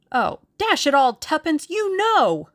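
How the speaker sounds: loud, climbing high in protest; exasperated outburst. A narrator's reading. Suitor protesting to the woman he loves, exasperated. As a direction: burst out, flustered and insistent.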